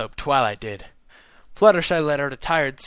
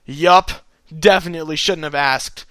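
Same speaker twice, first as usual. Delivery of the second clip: loud exclaim; 3 semitones higher, 7 dB louder than usual. Real, not acted. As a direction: blurt it out. Reader reacts loudly.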